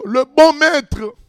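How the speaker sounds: impassioned preaching. Acted. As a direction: preach it hard, full of fire. Preaching at full force.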